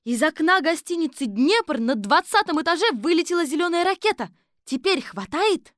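high, loud excited report; urgent high-voiced announcement; Kolbochkina crying out. A narrator's reading. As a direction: cry the news out to the chief. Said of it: "вскричала Колбочкина".

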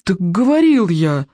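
high-pitched, aggrieved son; mildly wretched complaint to father; miserable protest. A narrator's reading. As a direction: answer back unhappily, pitch climbing. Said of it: "отозвался несчастный Икинг".

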